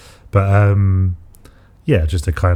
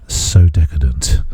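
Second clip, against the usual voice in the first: low, slow and savoring; indulgent; 5 semitones lower, 4 dB louder, slower than usual. Real, not acted. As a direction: relish every sound, slowly. Drooling over homemade Snickers.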